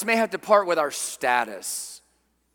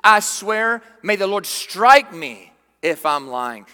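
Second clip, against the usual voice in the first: desperate oath; 8 dB louder, slower than usual. Retold or imitated. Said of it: "as it keeps pressing forward".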